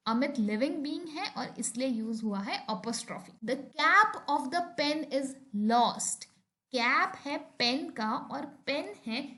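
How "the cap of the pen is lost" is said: very slow, each word set apart; slow example sentence. Shown as a demonstration. Teacher reading an example to the class, very slow. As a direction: read it out word by word. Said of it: "कुछ और examples देखते हैं".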